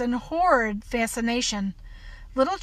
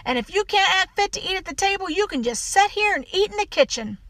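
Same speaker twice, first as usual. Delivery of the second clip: higher-pitched, forceful telling-off; scolding housekeeper; 5 semitones higher, 3 dB louder than usual. Acted. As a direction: lay into the child, no room for argument.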